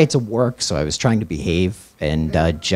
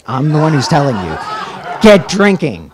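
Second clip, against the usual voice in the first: mock yell; 8 semitones higher, 12 dB louder than usual. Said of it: "harassing me more and says".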